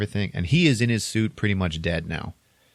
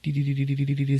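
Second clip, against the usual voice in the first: higher-pitched rapid electronic beeps; mock computer beeping; 6 semitones higher, much faster than usual. The ship's computer searches for Jim, imitated beeping. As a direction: imitate a computer scanning, beep by beep.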